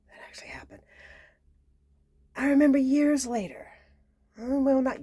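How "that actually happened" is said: hushed unvoiced whisper; whispered repeat. Shown as a demonstration. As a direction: breathe it as a secret.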